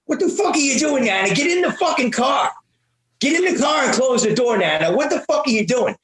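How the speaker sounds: high mock yell, impatient and demanding; ball-busting toddler. Toddler scolds great-grandmother from the car seat, high bossy yell. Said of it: "a little ball buster".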